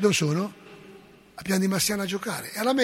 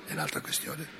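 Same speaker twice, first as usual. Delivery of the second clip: ordinary delivery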